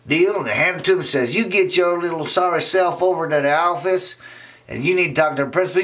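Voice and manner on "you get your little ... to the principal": scolding teacher. Acted. Teacher orders.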